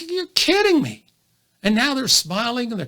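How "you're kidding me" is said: high, stretched-out incredulous squirrel reply; mock disbelief. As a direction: play an amazed listener, pitched way up. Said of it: "And they're like".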